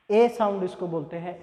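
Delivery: ordinary talk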